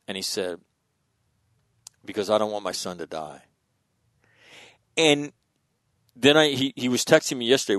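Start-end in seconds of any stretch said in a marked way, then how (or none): 2.0-3.4 subdued retelling of a choked-up father, slightly lower; retold emotional admission. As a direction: quietly imitate an emotional father, softer after a long pause. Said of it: "He gets emotional".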